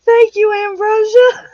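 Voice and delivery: high and loud, almost crying with laughter; real laughter breaking through the thanks; laughing. Real, not acted. Thanking a viewer for money while laughing hard. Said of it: "you have me laughing so hard I can't breathe".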